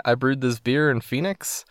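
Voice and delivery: ordinary delivery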